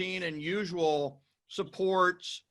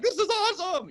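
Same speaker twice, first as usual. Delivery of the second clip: mock thrilled; 11 semitones higher than usual. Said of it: "it's like".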